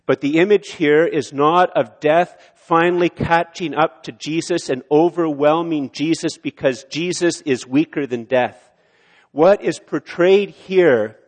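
loud, rising preacher's build; preaching at full force. Acted. Pastor preaching to the congregation, loud and building. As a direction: drive it home to the congregation.

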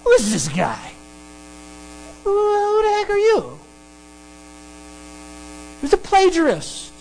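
snooty Athenian, rising into a high put-on voice; hammed-up haughty disdain, swooping high at the end. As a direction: sneer at the newcomer like a mock snob. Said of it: "snootiness of the Athens, of, of the Athenians".

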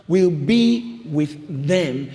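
slow, weighty Bible reading; solemn scripture reading. Acted. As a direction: read it slow like holy words. Reads scripture very slowly.

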